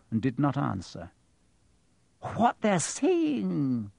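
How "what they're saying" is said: high, very slow, insistent lead-in; slow, high-pitched insistence; pressing on. A narrator's reading. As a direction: press on, drawing the words out. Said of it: "she pressed on".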